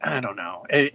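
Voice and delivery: ordinary talk, nothing marked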